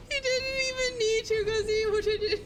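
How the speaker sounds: squealing with laughter; high-pitched laughing. Real, not acted. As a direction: talk through giggles, high and breathless. Squealing with laughter at the Minotaur bite.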